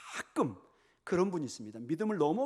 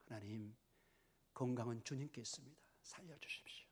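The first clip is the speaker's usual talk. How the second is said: very soft, near-whispered plea; hushed prayer; 4 semitones lower, 13 dB quieter than usual. Shown as a demonstration. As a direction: pray softly, as if alone before God. Demonstrates a prayer for healing. (나직하게 속삭이듯 드리는 기도)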